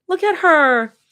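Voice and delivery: high, loud delighted squeal; excited. Acted. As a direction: gush over the cute bunny. Rumi delighted with bunny plush.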